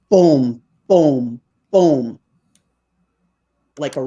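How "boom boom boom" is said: three spaced, falling booms; imitated booming. Storyteller imitating the reply heard in the bush, booming. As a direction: imitate a distant knock with a resounding "boom". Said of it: "they heard this".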